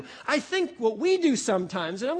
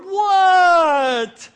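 loud, high, drawn-out mock-exasperated "What"; longest what ever; 7 semitones higher, 11 dB louder than usual. Child answering parents' request to talk, hammed-up long exasperated what. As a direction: stretch the word comically, pitched up. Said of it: "the longest what in the history of the world".

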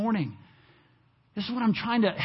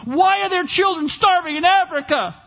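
higher, pointing-the-finger indignation; mock accusing; 8 semitones higher, 4 dB louder than usual. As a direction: hurl it like a finger pointed at God. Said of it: "Like they're pointing".

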